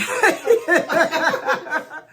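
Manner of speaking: loud, high burst of laughter; real hearty laugh. Real, not acted. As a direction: crack up at your own story. Laughs at her own story.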